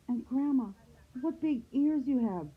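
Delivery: put-on high, wondering girl voice; puzzled little girl. A narrator's reading. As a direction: marvel in a high girlish voice. Girl marvels at odd grandma.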